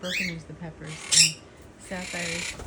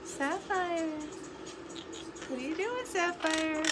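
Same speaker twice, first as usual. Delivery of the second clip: sing-song high call to the bird; high pet-talk voice; 8 semitones higher, 4 dB quieter than usual. Real, not acted. Owner calling up to her bird, high pet talk. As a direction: coo up at the bird.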